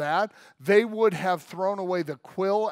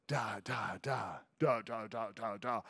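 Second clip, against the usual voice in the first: put-on lower, softer baby babbling; baby babble; 6 semitones lower, 8 dB quieter than usual. Shown as a demonstration. Father imitating his baby's babble, soft. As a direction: mimic a baby's jabber gently. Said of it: "start making the noise".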